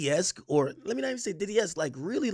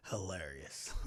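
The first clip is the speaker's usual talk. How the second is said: breathless whispered hilarious; whispered amused hilarious; whispered aside; 13 semitones lower, 16 dB quieter, slower than usual. Real, not acted. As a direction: murmur it through leftover laughter in a whisper. Laughing aside after the joke.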